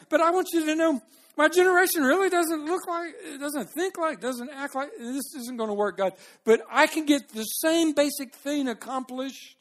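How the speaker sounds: high, loud, hurried pitch to God; bargaining with God. Acted. Believer negotiating with God, high insistent bargaining. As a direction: plead your case, then settle into your own way.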